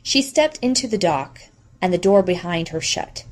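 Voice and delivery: ordinary delivery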